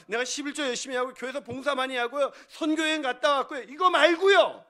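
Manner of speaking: rising from firm to loud shout; building impatient list. Acted. Preacher mimics churchgoers' boasts then rejects them, building to shouting. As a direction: pile up credentials, then burst out dismissing them. Listing church credentials, dismissed loudly.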